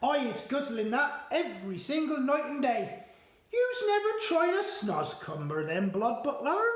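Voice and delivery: a kindly giant teasing his guest; BFG voice. Acted.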